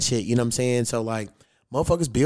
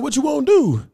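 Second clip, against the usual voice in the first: higher, sharper challenge, daring him; a raised, confrontational dare; fight challenge; 11 semitones higher, 4 dB louder than usual. Retold or imitated. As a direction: stare him down and dare him to step up.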